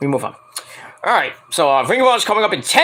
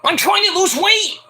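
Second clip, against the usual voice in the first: whiny referee voice; 13 semitones higher than usual. Pretend. Voicing Sinclair.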